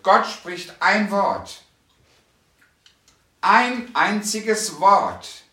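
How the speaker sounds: very slow, loud, high proclamation; preaching crescendo. Acted. Preacher declaring God's single word to the congregation, slow and loud. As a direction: preach every word to the hall.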